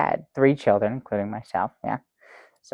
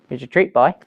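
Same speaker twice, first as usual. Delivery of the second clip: imitating father, gruff offer; 4 dB louder than usual. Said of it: "He's like".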